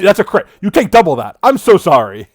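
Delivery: high, loud apology over the crit; excited. Real, not acted. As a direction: burst out at the bad roll. GM reacts to a critical hit.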